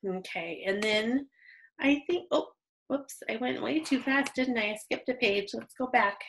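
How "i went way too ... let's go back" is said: usual speaking voice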